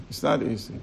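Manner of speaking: "It's not easy." dropped low and soft; quiet repeat. Shown as a demonstration. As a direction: let "It's not easy." sink softly.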